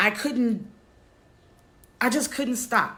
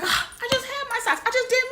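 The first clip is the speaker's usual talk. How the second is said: pitched way up, a fast panicky wail over lost socks; frantic high squeal; faster than usual. Retold or imitated. To herself over the missing sock, a high frantic wail. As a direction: squeak it out like everything's falling apart. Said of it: "I was like".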